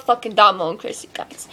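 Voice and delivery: a sheepish hushed confession; whispered swear. Real, not acted. Admitting to viewers she loves her friends, whispered. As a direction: whisper the swear word under your breath.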